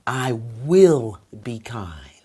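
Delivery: very slow, drawn-out promise; slow sincere affirmation. Shown as a demonstration. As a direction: take your time on each word. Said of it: "you wanna speak slow".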